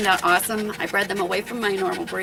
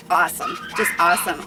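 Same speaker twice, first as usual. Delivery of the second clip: usual speaking voice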